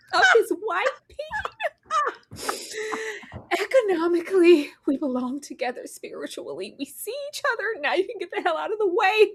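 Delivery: high, giddy, barely contained glee; excited gossip. Retold or imitated. Eleanor gloating to Tyra about the affair, high and giddy. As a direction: squeal it like the best news ever. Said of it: "she was excited".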